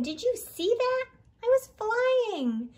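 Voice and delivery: bursting to tell everyone what happened; thrilled. Acted. Hot dog thrilled after the fall.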